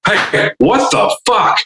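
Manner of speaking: high, slow, incredulous demand; offended outburst. Retold or imitated. Security guy bursts in an hour later, high mock outrage. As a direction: imitate an affronted guy, stretched out high. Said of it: "He's like".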